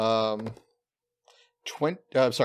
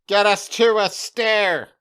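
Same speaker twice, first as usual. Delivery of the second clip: higher, louder slow order; urgent command; 7 semitones higher, 6 dB louder, slower than usual. Pretend. Goblin ordering Greg to the stairs, loud and urgent.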